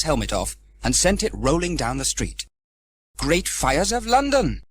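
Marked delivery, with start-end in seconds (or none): 3.2-4.6 slow, high startled cry; exclaiming. Acted. Sam losing his helmet to the wind, slow high exclamation. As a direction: draw out the alarm. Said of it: "exclaimed Sam".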